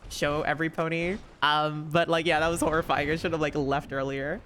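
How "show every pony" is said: ordinary delivery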